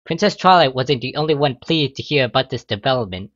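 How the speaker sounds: plain reading voice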